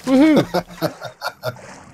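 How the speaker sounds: high laughing woohoo; excited whoop. Real, not acted. Cheering a big in-game moment.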